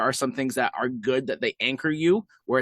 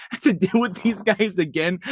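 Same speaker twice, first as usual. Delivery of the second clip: mock exasperation, pitched higher; higher, amused sarcastic grumble; retold sarcasm; 6 semitones higher, 4 dB louder than usual. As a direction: lightly imitate a fed-up lawyer. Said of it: "his sarcasm still coming through".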